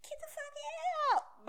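shrieky imitation at half volume; imitated yelling. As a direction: stretch out a hammed-up tearful yell. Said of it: "She was yelling at him".

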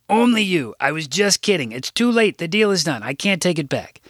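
a hint of a chatty older secretary; Rose voice. A narrator's reading.

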